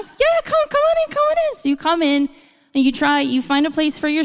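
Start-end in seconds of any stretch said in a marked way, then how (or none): 0.0-1.5 much higher, hurried welcome; high bright mock welcome; flustered host. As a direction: overcompensate like a frazzled host. Flustered host inviting guest in.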